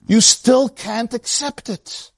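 ordinary delivery

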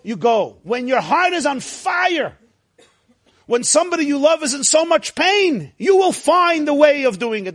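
normal talking voice

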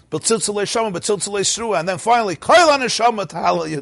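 rising fervour, calling out the praises; excited recitation. Real, not acted.

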